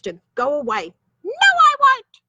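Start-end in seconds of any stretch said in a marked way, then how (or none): 1.2-2.2 very high, loud witch screech; shrieking wicked witch; defiant witch. Acted. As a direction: refuse God with a shriek. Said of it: "said the witch".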